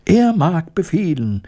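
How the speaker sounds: high mouse voice, eager call. A narrator's reading. Mice answer their queen as a chorus, calling out high. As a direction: pipe up like obedient mice. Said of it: "riefen die Mäuse im Chor".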